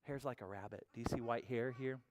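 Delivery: ordinary delivery, nothing marked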